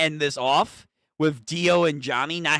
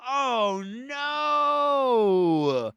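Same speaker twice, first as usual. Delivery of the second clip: usual speaking voice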